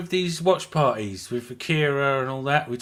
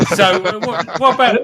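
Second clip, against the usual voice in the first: talking through real laughter, high and loud; laughing; 8 semitones higher, 7 dB louder than usual. Real, not acted. Host tries to change the subject after a crude joke, laughing. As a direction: try to move on while cracking up.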